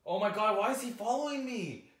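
high frantic yelling; panicking gamer. Real, not acted. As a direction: panic as the mob closes in. Mob chasing in game.

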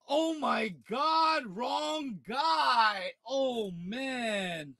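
high, drawn-out wail of disappointment; agonized groaning lament; anguished dismay. Real, not acted. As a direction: wail it slowly, letting it sink. Wanted card turns out wrong.

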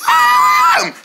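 very high-pitched shriek; sudden piercing squeal in place of an answer. Acted. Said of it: "Why you keep shrieking like that".

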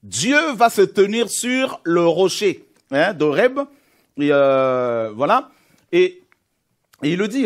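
loud, pressing preacher's call; fervent preaching. Acted. Preacher pressing the point home to the congregation, fervent.

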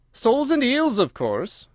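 high start, falling away on "of course"; rather impatient. A narrator's reading. Gryphon answers Alice's question, rather impatient. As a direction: toss it out as if obvious. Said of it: "replied rather impatiently".

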